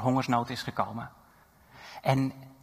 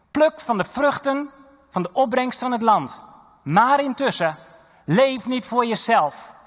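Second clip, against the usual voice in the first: much higher and louder, calling out with joy; exuberant preaching; 6 semitones higher, 6 dB louder than usual. Acted. Preacher voicing Joseph to the people, jubilant and loud. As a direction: cheer the crowd on, then press the warning home.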